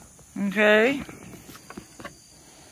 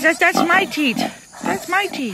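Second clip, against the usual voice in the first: much higher, indignant little piglet; squeaky piglet voice; 10 semitones higher than usual. Acted. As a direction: play a greedy piglet guarding its teat. Voicing a piglet fighting over teat.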